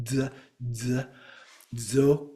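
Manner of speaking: "d, d, zo" as separate short buzzed dz sounds; combined DZ sound. Shown as a demonstration. Teacher breaking down a word, "d, d, zo" as dzuh, dzuh, dzo. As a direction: sound out "zo" with a D fused onto the Z. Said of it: "It starts with the D Z".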